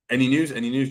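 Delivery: usual speaking voice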